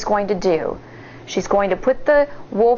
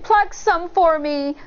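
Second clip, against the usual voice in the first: begging; 7 semitones higher than usual. A narrator's reading. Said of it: "the wolf begged".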